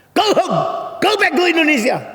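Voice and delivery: high, loud angry shout; yelling to expel. Retold or imitated. Dutch woman drives away Indonesian preacher, loud high yell. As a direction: shout them away at the top of the voice. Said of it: "Dia teriak-teriak mengusir".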